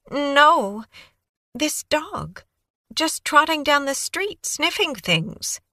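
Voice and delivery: put-on high, breathless young woman babbling on; Kaylee's eager voice. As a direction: gush it out like a nervous, eager witness. Kaylee recounting.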